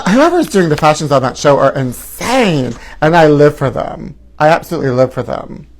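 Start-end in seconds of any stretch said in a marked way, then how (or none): none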